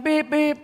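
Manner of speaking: high piping beep beep; imitated horn. Jaguar driver honking behind the Volkswagen, high beeps. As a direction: honk like a horn with the voice. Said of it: "He's going".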